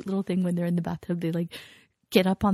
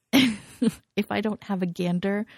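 normal talking voice

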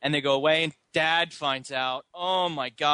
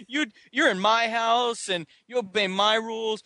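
higher-pitched, hammed-up scolding father; mock stern dad; 6 semitones higher than usual. Dad laying down house rules to his son, mock stern scolding. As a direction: lay down the law as a cartoonish dad. Voicing strict dad in film argument.

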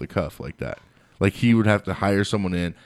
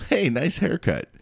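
fake cheer; 9 semitones higher, slower than usual. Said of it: "It was literally like".

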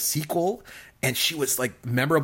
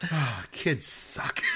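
exasperated; 6 dB quieter, much slower than usual. Retold or imitated. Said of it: "exasperated by these kids".

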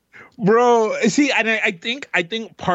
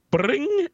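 imitated ring; 8 semitones higher than usual.

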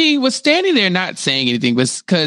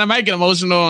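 normal talking voice

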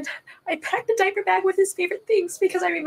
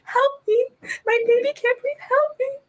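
faint plea for help; 8 semitones higher than usual. Retold or imitated. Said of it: "I couldn't yell again".

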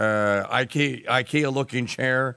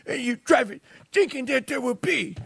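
high, shouted mock Greta Thunberg; Greta rant; 11 semitones higher than usual. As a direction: screech it like an outraged teen activist. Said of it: "Greta impression, mocking".

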